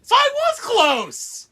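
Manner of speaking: very high, drawn-out squeal; mock triumph. Co-host claiming her guess was close, squeaky mock triumph. Joking boast after wrong guess.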